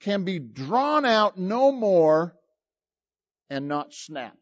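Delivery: slow, stretched words; drawn out. Shown as a demonstration. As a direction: draw the words out long. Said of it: "Let me tell you what long suffering is".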